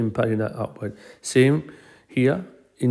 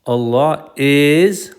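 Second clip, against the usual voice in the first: "is" held very long, louder and higher; stress on "is"; 4 semitones higher, 6 dB louder, much slower than usual. Shown as a demonstration. Teacher shows students the double emphasis, stressing "is". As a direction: lean hard and slow on "is". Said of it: "you have double emphasis here".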